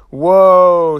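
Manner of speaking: loud, high teasing whoa; a kid's delighted whoa at a secret; surprised whoa. A narrator's reading. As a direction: call out in gleeful surprise. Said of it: "Summer and I both said".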